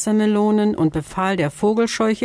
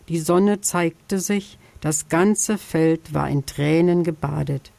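ordinary delivery, nothing marked

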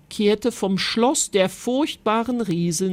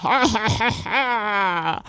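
giantess laughing; slower than usual. Acted. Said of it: "lachte die Riesin".

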